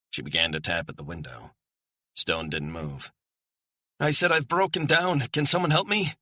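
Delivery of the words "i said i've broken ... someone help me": very high, insistent cry for help; louder, urgent shouting. A narrator's reading. Woman gets no answer from the house, louder urgent shouting. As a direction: shout it desperately, pitched right up. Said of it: "Her shouting was getting louder, more urgent".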